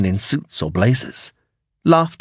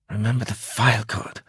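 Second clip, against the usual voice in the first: muttered; 7 dB quieter than usual. Acted. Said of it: "she muttered".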